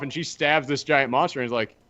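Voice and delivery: normal talking voice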